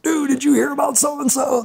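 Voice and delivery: put-on high-pitched teenage rumor-spreading; put-on high, eager question; excited gossip. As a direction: imitate a high schooler passing on gossip. Acted high school gossip spreading.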